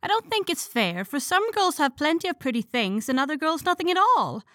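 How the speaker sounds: put-on high, petulant little girl; injured, sulky. A narrator's reading. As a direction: whine it like a hurt child. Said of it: "added little Amy with an injured sniff".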